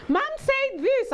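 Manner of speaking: very high slow teasing singsong; mocking daughter. Daughter telling friends about mom, very high slow tease. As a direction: draw it out like a tattling kid. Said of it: "she say".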